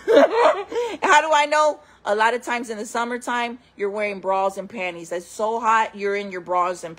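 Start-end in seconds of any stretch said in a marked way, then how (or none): 0.0-1.8 slow, high laughing question. Real, not acted. Joking with viewers about how she knew, laughing. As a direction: laugh through the words. Laughing at her own story.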